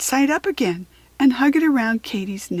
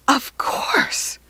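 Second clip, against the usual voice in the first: excited; 5 semitones higher than usual. A narrator's reading. Katy's sudden idea.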